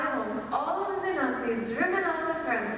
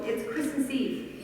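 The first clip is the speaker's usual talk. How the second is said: ordinary talk, nothing marked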